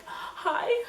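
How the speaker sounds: very high, small frightened hi; high-pitched timid hi; tiny scared hi. Retold or imitated. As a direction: squeak it out in fear. Scared call to mom.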